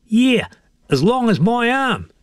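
high, put-on old fisherman voice; high-voiced fish-tale boaster; boastful old Bill. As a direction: brag in a high character voice.

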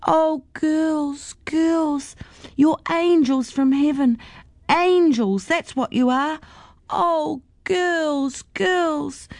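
grateful, near tears, lightly voiced; tearful old lady. A narrator's reading. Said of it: "had tears rolling down her face".

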